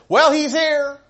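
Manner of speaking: high, loud call at the door; announcing an arrival. Acted. Someone announces commander at door.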